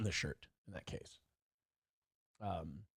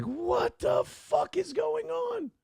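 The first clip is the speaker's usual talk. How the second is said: much higher, slow, drawn-out bafflement; stunned disbelief; 5 dB quieter, much slower than usual. Retold or imitated. Learning nobody saw it happen, he asks slowly, much higher, baffled. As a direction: stretch it out, utterly bewildered.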